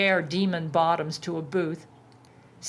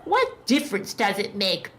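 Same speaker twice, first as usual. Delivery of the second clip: spat retort. A narrator's reading. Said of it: "Baphomet spat".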